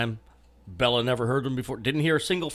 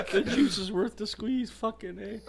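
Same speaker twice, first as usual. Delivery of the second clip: laughing, fading out; 8 semitones higher than usual. Real, not acted. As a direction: laugh through the words. Laughing after a joke.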